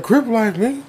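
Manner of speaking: high-pitched mock gang boast; cocky kid. Kid boasting at the driver, imitated cockiness. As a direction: play a small kid acting tough. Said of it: "started saying".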